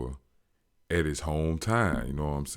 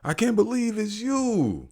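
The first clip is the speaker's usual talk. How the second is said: much higher, gushing fan; starstruck. Retold or imitated. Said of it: "he excited".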